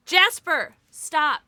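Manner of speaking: calling the pet off; sharp scold. Real, not acted. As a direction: snap at the dog to stop.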